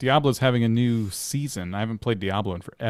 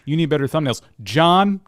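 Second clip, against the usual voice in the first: higher, louder, with the name punched out; crudely edited-in name; 6 semitones higher, 5 dB louder than usual. Shown as a demonstration. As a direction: make the name jump out like a crude splice. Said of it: "crudely edit myself in later where I go".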